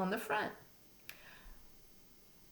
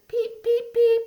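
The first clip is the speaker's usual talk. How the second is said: very high squeaky peeps; spring peeper call; 9 dB louder than usual. Shown as a demonstration. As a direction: chirp "Peep" way up high like a tiny frog. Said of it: "A spring peeper says".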